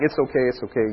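low, soft fatherly reassurance; soothing. Retold or imitated. Father comforting his crying daughter, soothing and soft. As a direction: soothe a crying child, softly. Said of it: "My daughter starts crying, I'm like".

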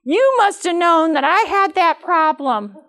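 high, loud accusation, pointing a finger from the pew; accusing congregant. Retold or imitated. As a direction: play the offended church lady who thinks the sermon was aimed at her. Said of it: "somebody in the congregation will go".

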